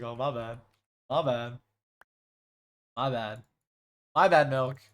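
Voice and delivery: low acted backing-down; low-voiced repeated mock apology; mock meek apology. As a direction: drop the pitch and concede, playfully.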